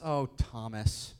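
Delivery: a slow, fond "Oh, Thomas"; fond sigh. Retold or imitated. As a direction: sigh his name fondly, like a patient friend. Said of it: "I love this".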